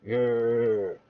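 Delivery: low growling dog. Acted.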